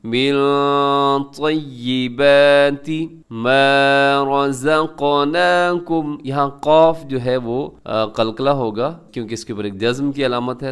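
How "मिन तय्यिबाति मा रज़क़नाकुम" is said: slow recitation, humming the n of मिन; ikhfa. Shown as a demonstration. Teacher reciting the phrase for students, ikhfa on मिन. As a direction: hold "मिन" nasally, stretch "मा" and the ना of "रज़क़नाकुम". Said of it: "इख्फा का जो कानून".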